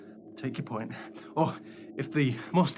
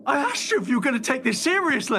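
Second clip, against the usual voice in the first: exasperated; 9 semitones higher, 4 dB louder than usual. Acted. Exasperated at flippant partner.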